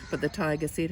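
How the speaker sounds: ordinary delivery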